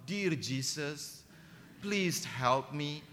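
slow, drawn-out mock prayer; mock pious prayer. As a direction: pray it slowly like a sly kid. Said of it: "Saya doa". (doa pura-pura saleh, pelan)

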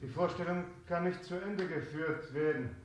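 subdued, stricken announcement; shaken announcement. Real, not acted. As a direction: break the news gently.

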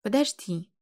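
understated narrated exclamation; a small, contained cry of "wait"; exclaiming. A narrator's reading. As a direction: call out lightly, as if stopping someone leaving. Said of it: "воскликнула".

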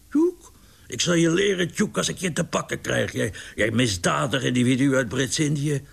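high, sputtering scolding; exasperated threat. A narrator's reading. Larry cursing the pigeon that will not come down, angry. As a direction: lose patience and threaten the bird.

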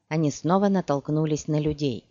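ordinary delivery, nothing marked

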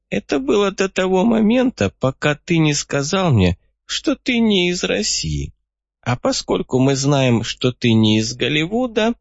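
put-on a crafty old man pleased with himself. A narrator's reading. Old man John explains.

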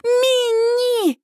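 high, desperate wail; panicked high-pitched cry; frantic scream. A narrator's reading. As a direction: scream for your child. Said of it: "истошно ору я".

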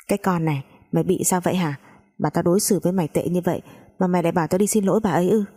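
ordinary delivery

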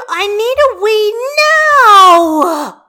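put-on high, loud, drawn-out child's wail; a desperate little girl begging; whiny little girl. A narrator's reading. Child figure Hestia.

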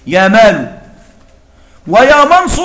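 "يا مال" and "ويا منص" each ending in a damma. Shown as a demonstration. Teacher to students, reading "يا مال" and "ويا منص" with final damma. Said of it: "وهي قراءة أبي السري الغنوي".

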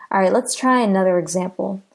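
normal talking voice

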